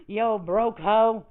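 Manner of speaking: slow, stretched mocking call; slow drawn-out taunt. To the rival on stream, slow drawn-out taunt. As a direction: drag it out to taunt her.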